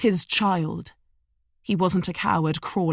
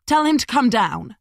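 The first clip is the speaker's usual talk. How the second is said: sharp high snap at him; snapped; 6 semitones higher, 5 dB louder than usual. A narrator's reading. Madeline snapping at Smith about Liam. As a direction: bite the order off. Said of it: "Madeline snapped".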